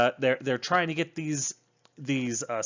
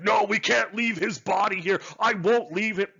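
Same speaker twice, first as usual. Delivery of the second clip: hammed-up stubborn hero, higher pitched; mock heroic defiance; 7 semitones higher, 4 dB louder than usual. Mocking a crewmate refusing to leave the body, hammed-up. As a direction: parody of a melodramatic crewmate refusing orders. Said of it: "Nobody's like".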